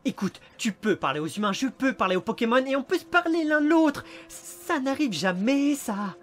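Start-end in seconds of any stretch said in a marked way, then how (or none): 1.5-5.8 raised, louder, building insistence; impassioned plea. Acted. Detective persuades his reluctant partner, impassioned and insistent. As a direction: plead as if it were a miracle.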